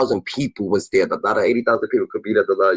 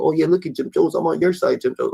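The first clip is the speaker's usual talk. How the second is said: higher, mocking eager tone; sarcastic fanboy impression, higher; mocking sucking-up voice; 7 semitones higher than usual. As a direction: parrot a fan sucking up, pitched up. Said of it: "You wanna show people".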